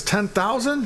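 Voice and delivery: high-pitched acted disbelief; incredulous buyer. Buyer guesses the dog's price, high incredulous question. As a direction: query it in mock astonishment.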